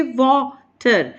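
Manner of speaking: Water split in two, weight on वा; stress on first syllable. Shown as a demonstration. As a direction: lean on वा in water, then a separate टर. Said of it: "उस पर stress देंगे". (Water में पहले हिस्से वा पर ज़ोर, टर अलग)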